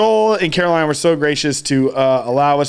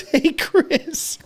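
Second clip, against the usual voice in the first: put-on high-pitched child's greeting; high, innocent kid call; little kid; 10 semitones higher than usual. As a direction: imitate a small boy leaning over to say hi. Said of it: "he goes".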